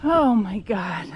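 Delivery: loud, slow cry of relief; relieved laughter. Real, not acted. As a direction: let it out, finally done. Finally reaches the end after a tough hike.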